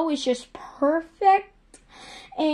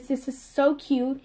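usual speaking voice

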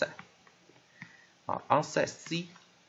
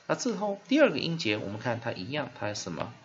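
ordinary talk, nothing marked

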